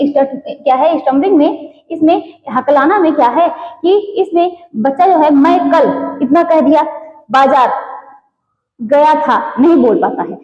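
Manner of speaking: usual speaking voice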